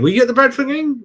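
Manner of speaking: asking the Bradford game question again, eager and high; eager repeat. Real, not acted. Fan asking his mate whether he was at Bradford, eagerly and pitched high.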